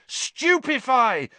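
high, loud, slow spell cry; yelled spell. A narrator's reading. Harry casting at the giant spider, yelled. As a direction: hurl the spell out. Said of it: "Harry yelled again".

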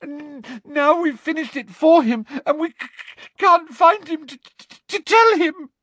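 high, stuttering, near tears; Hermione's high, tearful stammer; gasping stammer. A narrator's reading. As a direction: gasp out the lie between sobs. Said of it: "gasped Hermione".

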